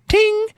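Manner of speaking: very high-pitched vocal "ting" sound effect; ping it out high like metal struck; imitated metallic ting. Imitated noise within cowboy impression.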